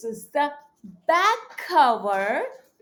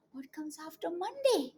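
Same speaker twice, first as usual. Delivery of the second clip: plain reading voice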